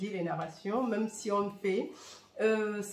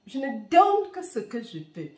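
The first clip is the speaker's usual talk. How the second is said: normal talking voice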